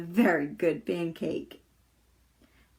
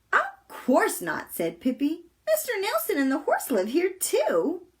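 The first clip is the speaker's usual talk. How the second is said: put-on matter-of-fact little girl, pitched up; Pippi; 7 semitones higher than usual. A narrator's reading. Pippi answering in the story.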